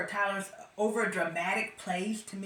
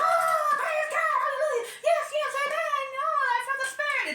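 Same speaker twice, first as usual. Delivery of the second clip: gullible churchgoers; 6 dB louder than usual. Retold or imitated. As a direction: ham up the ecstatic congregation. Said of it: "everybody goes".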